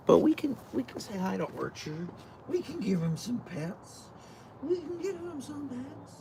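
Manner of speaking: high, soft cooing to a pet; cooing pet talk. Real, not acted. Streamer greeting her dog Archer, soft high cooing.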